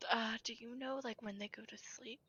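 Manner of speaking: soft secretive whisper. Acted. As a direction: lean in and whisper it. Character whispering to companion.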